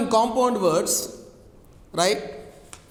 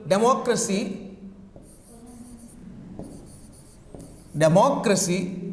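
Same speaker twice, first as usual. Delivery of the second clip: democracy stressed on "moc"; stress on second syllable. Shown as a demonstration. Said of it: "where do we put the stress".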